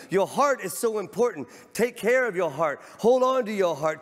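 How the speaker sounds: fervent pulpit exhortation; full-force preaching. Acted. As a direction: exhort the congregation, rising.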